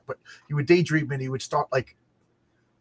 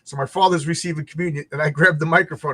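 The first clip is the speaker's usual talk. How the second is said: usual speaking voice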